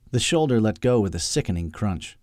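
ordinary delivery

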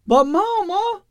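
put-on very high, childish wail; whiny boy's cry. A narrator's reading. Son crying out to his mother, whiny childish wail. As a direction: cry out like a whining boy. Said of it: "The cry was pure Eustace".

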